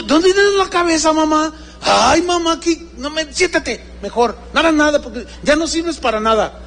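high, impatient telling-off; high-pitched acted reproach; mock scolding. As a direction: imitate an exasperated child berating mother. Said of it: "ahora me regañan".

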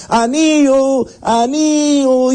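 high drawn-out street cry; calling out. Acted. Young man calls out at the market door, high, slow hawker's cry. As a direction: hawk the ring to passers-by, stretched slow.

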